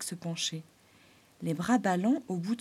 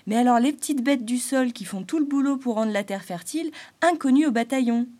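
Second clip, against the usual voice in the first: higher, louder resentful grumble; Arcan grumbling; 4 semitones higher, 8 dB louder than usual. Acted. Springtail complaining about ignored soil creatures. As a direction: complain about being forgotten.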